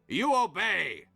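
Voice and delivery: commanding. Acted. Ancient figure ordering obedience, imperious. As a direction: strike it as an absolute command.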